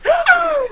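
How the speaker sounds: sharp high imitated gasp; mock class gasp. Classmates hearing the insult, high shocked gasp.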